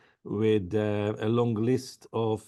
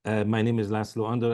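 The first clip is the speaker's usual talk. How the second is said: normal talking voice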